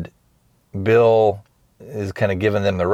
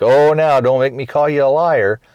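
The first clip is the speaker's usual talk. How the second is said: playful mock scolding, higher and louder; teasing; 6 semitones higher, 5 dB louder than usual. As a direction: tease her like a cheeky guest. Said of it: "he's kinda teasing her".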